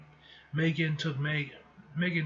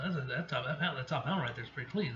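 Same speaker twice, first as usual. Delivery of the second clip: plain reading voice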